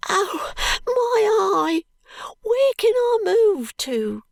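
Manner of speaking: put-on very high, slow, tearful street boy; crying boy. A narrator's reading. Ragged boy pleading with the constable, high and crying. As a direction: wail it like a desperate ragged child. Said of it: "cries the boy".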